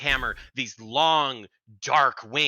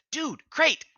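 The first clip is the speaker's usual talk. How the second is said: very high put-on cat voice; high-voiced cat remark; cat character. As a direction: speak up as the cat, pitched high. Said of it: "the cat finally says".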